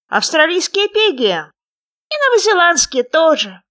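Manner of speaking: high, loud, squeaky little frog; small creature's high, loud voice. A narrator's reading. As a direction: pipe up eagerly as a tiny frog. Unseen frog keeps shouting back.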